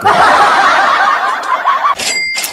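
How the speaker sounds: a sudden squeaky unvoiced laugh; amused high-pitched laugh; high breathy laugh. Real, not acted. Laughs at the viewer's mistake.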